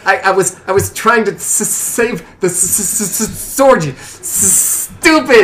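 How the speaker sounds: high stammer, bursting out at the end; indignant sputter. Acted. Father defending himself to daughter, indignant high stutter. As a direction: sputter in protest, spitting the s sounds. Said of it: "Say it, don't spray it".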